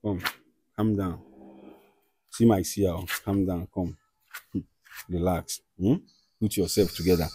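soft, low hushed reassurance; soothing calm down. Real, not acted. Host quietly calming an agitated guest, hushed and soothing. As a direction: gently settle him down.

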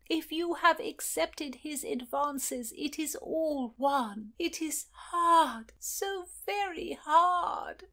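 high, slow, drawn-out lament; moaning lament. Acted. Aunt grieving over her niece's choice, slow high lament. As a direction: wring each word out in grief. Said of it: "made that dreaded moan again".

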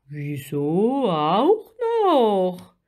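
very slow, swooping creature voice; big puzzled question, drawn out; curious giant octopus. A narrator's reading. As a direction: stretch it like an inquisitive sea giant. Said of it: "fragte der Riesenkrake neugierig".

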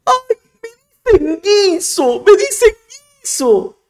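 high, loud whimpering mockery of a crybaby; mock crybaby whine. Vlogger mocking the philosopher as a crybaby, high whiny whimper. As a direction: whine it like a kid about to cry. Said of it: "está llorando".

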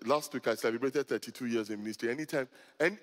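ordinary talk

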